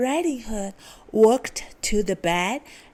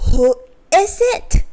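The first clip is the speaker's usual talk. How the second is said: louder gruff wolf; 8 semitones higher, 9 dB louder than usual. A narrator's reading. Wolf in grandma's bed answering the knock, gruff and loud. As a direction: bark it out gruffly. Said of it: "came the gruff wolf voice".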